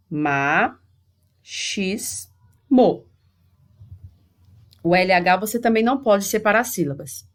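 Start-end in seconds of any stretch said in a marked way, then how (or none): 0.1-3.0 each syllable said alone with pauses between. Shown as a demonstration. Teacher showing where you separate the word, syllable by syllable. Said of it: "Você separa aqui".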